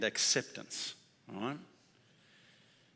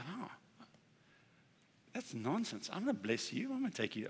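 gentle reassuring voice; 4 semitones higher, 7 dB quieter than usual. Retold or imitated. Voices God's gentle reply.

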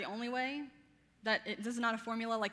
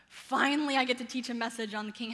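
excited thought; 5 dB louder than usual. Retold or imitated. Teacher retelling her thought on getting this message, excited. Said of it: "I thought".

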